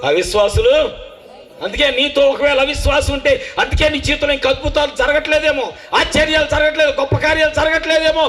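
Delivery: high, loud shouting sermon; full-force preaching. Acted. Preacher warning the congregation about unbelief, shouting. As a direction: drive it home to the congregation.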